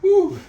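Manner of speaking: loud, breathless blown-out whew; tired whew. Real, not acted. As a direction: blow out hard after the effort. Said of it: "That's a workout".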